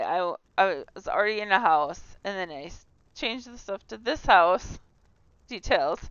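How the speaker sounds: ordinary talk, nothing marked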